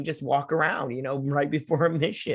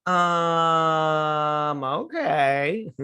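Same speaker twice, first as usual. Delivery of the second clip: long drawn-out higher-pitched doubt; sceptical mock hesitation; 4 semitones higher, much slower than usual. Reacting to a silly plot turn, drawn-out dubious hesitation. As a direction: stretch the um, then a questioning okay.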